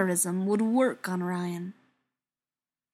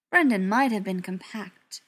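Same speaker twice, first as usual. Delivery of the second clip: ordinary delivery, nothing marked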